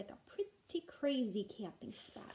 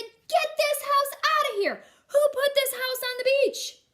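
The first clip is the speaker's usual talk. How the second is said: angry judge; 13 semitones higher, 14 dB louder than usual. Acted. Judge ordering the house off the beach, loud and angry. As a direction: boom it out like an outraged official.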